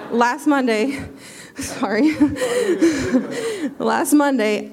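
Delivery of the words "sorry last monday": giggling through the words; flustered laugh while speaking; nervous laughing. Real, not acted. Nervous laugh mid testimony.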